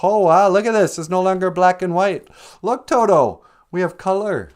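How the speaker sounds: high, loud mock-Dorothy wonder; amazed excitement. Dorothy showing Toto the color, amazed and excited. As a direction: exclaim it in overacted awe, pitched well up.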